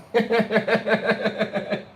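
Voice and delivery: high, loud helpless laughter; high giggle fit. Real, not acted. Painter on stream losing it, high helpless giggling. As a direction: lose it completely. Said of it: "it's too funny".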